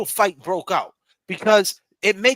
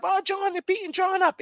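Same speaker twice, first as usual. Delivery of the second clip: panicked; 14 semitones higher than usual. Retold or imitated. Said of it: "Let's just say she tried to break the fight up".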